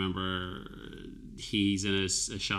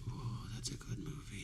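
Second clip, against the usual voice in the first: soft unvoiced whisper; 3 semitones lower, 17 dB quieter, slower than usual. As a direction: breathe it like a secret. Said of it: "I was like".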